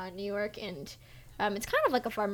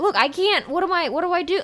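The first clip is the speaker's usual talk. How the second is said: higher, louder exasperated retort, lightly voiced; mock an egg seller losing patience, a bit louder; annoyed seller; 7 semitones higher, 7 dB louder than usual. Egg seller fed up with her questions, imitated exasperation. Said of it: "starting to get really annoyed with me".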